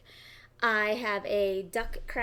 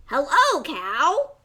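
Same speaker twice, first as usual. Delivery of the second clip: louder, higher friendly duck greeting; cheery duck; 6 semitones higher, 12 dB louder, slower than usual. Acted. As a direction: call out a bright hello. Duck greets cow in story.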